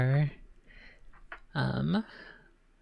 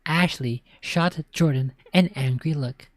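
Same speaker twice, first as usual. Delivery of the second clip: ordinary delivery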